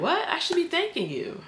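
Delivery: high, incredulous retold quote; high-voiced mock reply. Man answering her thanks, high mock voice.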